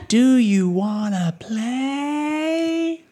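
very drawn-out, high singsong call; playful call. Acted. Player's character calling out to her, high playful call. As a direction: stretch it out high, inviting her to play. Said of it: "I just shout out to her".

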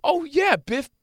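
high, slow, sly oh yeah to turn it on the boss; lightly acted smug reply; knowing one-up. Shown as a demonstration. Host demonstrating how to answer a boss, lightly smug. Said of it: "what you're supposed to say now is".

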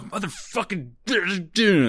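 high, drawn-out aggressive curse; aggressive expletive. Shown as a demonstration. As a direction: let the swear word tear out, hammed up. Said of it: "it feels more".

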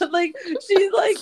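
breaks into high laughter mid-word; can't get it out for laughing; squeaky laugh through the words. Real, not acted. Laughing at Brittany's video.

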